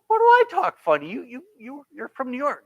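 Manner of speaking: high-pitched retold disbelief; indignant surprise. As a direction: squeak it out like an offended kid. Retold childhood argument with cousins.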